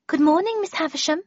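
a humble, polite washerwoman. A narrator's reading. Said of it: "she said politely".

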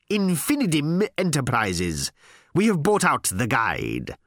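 slow, high, emphatic announcement; corporate proclamation. Acted. New boss repeats the company name to writer, emphatically. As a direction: spell out the takeover with pomp.

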